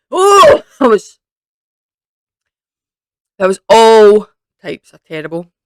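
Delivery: loud, high disgusted wail after a foul taste; real disgust. Real, not acted. Vlogger gagging on a spoiled-milk jelly bean, loud disgusted wail. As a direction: let the revulsion burst out loud.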